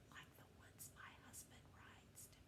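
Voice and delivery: hushed, voiceless aside; confiding whisper. Pretend. Teacher confiding to class about husband's poems, whispered. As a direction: whisper it like a secret husband mustn't hear. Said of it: "I have to whisper".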